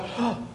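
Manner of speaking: a quick audible intake of breath; sharp demo gasp. Shown as a demonstration. Illustrating inspiration versus expiration.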